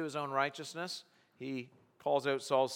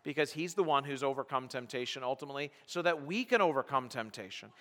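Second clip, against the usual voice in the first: ordinary delivery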